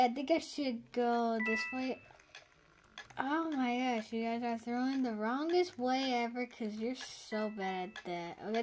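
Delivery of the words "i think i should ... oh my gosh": ordinary talk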